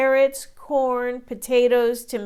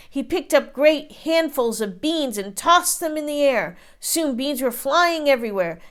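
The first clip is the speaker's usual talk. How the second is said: plain reading voice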